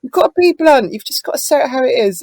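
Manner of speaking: high, loud mimicked Leeds accent. Co-writer insisting she be blunt, mimicked Leeds accent. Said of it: "with his lead accent".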